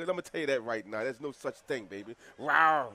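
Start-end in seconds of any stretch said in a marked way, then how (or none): 2.4-2.9 loud playful rawr; tiger roar. Pretend. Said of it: "That's a tiger roar".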